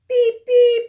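loud, high beep beep; bus horn beep. Acted. Teacher imitating a bus horn, loud and high. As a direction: honk like a big bus.

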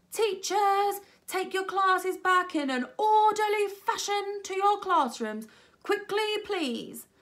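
high, loud, slow orders; commanding teacher. A narrator's reading. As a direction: call instructions across a chaotic hall. Missus Sanders commanding the hall.

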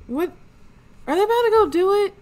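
fast, high, loud disbelief; shocked. Real, not acted. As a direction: blurt it out in disbelief. Shocked by the Sims' actions.

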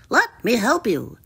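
obliging, croaky frog; courteous froggy voice; croaking frog. A narrator's reading. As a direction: offer help in a frog's croak. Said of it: "he croaked".

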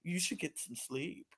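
soft, low mock-flippant aside. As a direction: toss it off quietly, imitating a dismissive remark. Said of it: "with that little flippant move".

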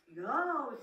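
eager call. A narrator's reading. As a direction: pipe up loudly, ready for food. Coyote calls out the same answer.

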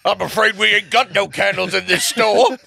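sketch shopkeeper voice. Acted. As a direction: play a put-on shopkeeper in a skit.

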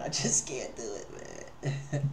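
sheepish whisper, half-laughing at yourself; whispered confession. Real, not acted. Streamer admitting to chat he can't take hard music, whispered. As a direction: whisper it like a small admission.

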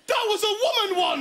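high, laughing exclamation; mock alarm. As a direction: blurt it out to your mate. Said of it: "Ed straight away went".

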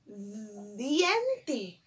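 "Diente" very slow, lingering on the first D; stretched initial sound. Shown as a demonstration. Teacher introducing a word with D, "Diente" drawn out from its first sound. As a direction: hold the D at the start of "Diente". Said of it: "diente también se escribe con D".

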